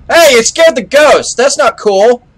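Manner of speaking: high, loud indignant shout; excited protest. Real, not acted. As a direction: yell it in mock outrage. Ghost got scared.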